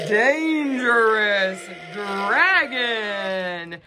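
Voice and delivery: "Dangerous dragon" drawn out, leaning on each d; teacher stressing the two d's; slow alliteration. Shown as a demonstration. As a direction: say it slow and spooky. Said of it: "Both begin with a d".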